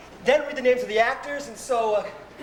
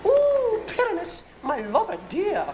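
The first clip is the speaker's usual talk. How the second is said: high, cooing mock lady voice; monstrous little voice; 9 semitones higher than usual. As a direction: coo it like a tiny dainty maiden. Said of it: "I will speak in a monstrous little voice".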